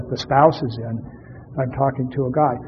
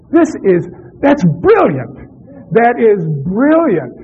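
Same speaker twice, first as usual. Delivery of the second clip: high, loud, thrilled "brilliant"; delighted praise; 6 semitones higher, 5 dB louder, slower than usual. Real, not acted. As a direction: cheer the answer on, really delighted. Delighted by audience's answer.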